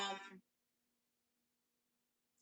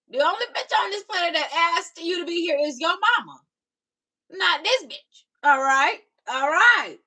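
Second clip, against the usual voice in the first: loud, higher sassy clapback; 4 semitones higher, 4 dB louder than usual. Real, not acted. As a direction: put the troll in their place. Responde al troll con furia.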